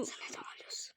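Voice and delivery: soft whispered question. Real, not acted. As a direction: whisper it to him. Whispering in game.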